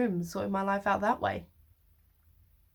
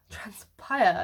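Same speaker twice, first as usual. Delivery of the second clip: transpire with the pie punched louder, falling; stress on pie; 6 dB louder than usual. Shown as a demonstration. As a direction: lean hard on the pie in transpire. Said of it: "I put emphasis on the pie".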